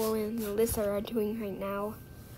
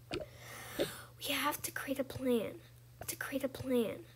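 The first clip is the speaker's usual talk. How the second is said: hushed plotting whisper; 9 dB quieter, slower than usual. Acted. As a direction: whisper so nobody hears. Kids plotting in secret.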